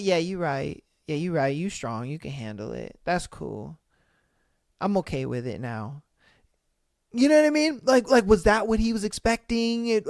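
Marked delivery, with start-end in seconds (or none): 0.0-6.0 low, soft, fading dull voice sinking lower; mock dopey girlfriend. Streamer mocking the girlfriend's expected answer, sinking low dull voice. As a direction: sink lower and quieter like a dazed pushover. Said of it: "she was supposed to just be like".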